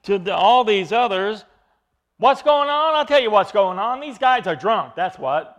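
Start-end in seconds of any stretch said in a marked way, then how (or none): 2.2-5.5 loud jeering shout, high and mocking; mock heckler's shout; shouting mockers. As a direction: yell it across the crowd like a heckler. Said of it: "these were the ones who were shouting".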